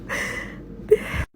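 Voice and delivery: a long, shaky breath out; heavy sigh. Real, not acted. Broke and panicking, she sighs into the camera. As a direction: let the air go, worn out.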